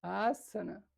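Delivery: asana with a held "aa" at the start; lengthened first vowel. Shown as a demonstration. Teacher repeating it for students, asana said "aasana". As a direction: stretch the opening of asana. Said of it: "se dice asana".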